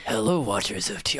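loom over them like death; grim reaper voice. Acted. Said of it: "oh, no, no, I can't".

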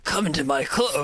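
sly creepy invitation. Acted. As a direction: lure them in, spooky. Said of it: "Don't make this creepy".

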